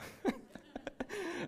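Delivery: a short airy delighted laugh; breathy laugh. Real, not acted. Laughs at the miracle.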